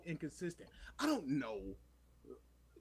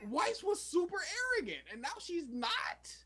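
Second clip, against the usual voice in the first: incredulous exasperation; 12 semitones higher than usual. Real, not acted. Fan objecting to a changed character, high and incredulous. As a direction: stretch the ending in disbelief. Ranting about inconsistent characters.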